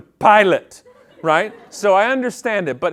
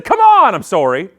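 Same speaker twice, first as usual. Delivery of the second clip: angry outburst then apology; 2 semitones higher, 8 dB louder than usual. Acted. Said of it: "I think I said".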